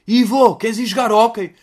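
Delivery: loud, high-pitched invitation shouted to a friend; calling out. Acted. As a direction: playact a kid hollering to a pal. Acted friend calls fat Ivo.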